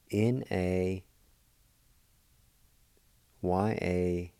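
letters spelled out slowly with pauses. Shown as a demonstration. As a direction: spell it letter by letter, drawing each out. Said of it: "it's spelled like this".

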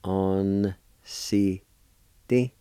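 "On, si, di" in separate held syllables. Shown as a demonstration. Teacher showing learners the second part of hammock, syllable by syllable. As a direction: pause after each sound of "On, si, di". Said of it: "pronounce the N sound with the A like this".

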